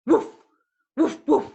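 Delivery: playful woof woof barking; dog bark. Shown as a demonstration. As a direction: bark like a friendly dog for children. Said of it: "bark like a dog?".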